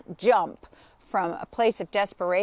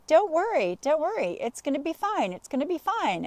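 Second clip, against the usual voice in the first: higher-pitched, lightly imitated soothing boyfriend; sing-song mock comforting; mock reassurance; 7 semitones higher than usual. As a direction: imitate someone repeatedly telling you not to worry. Retells boyfriend soothing her all day.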